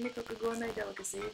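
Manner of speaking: ordinary talk, nothing marked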